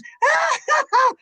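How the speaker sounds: very high, loud mock-hysterical cackle; piercing hammed-up scream-laugh; shrieking cackle. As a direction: shriek with wild laughter like a startled char lady. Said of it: "And she goes".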